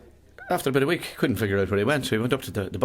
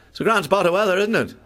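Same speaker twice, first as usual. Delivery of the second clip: cheery chat; 10 semitones higher, 5 dB louder than usual. Acted. Chatty neighbour in cubicle.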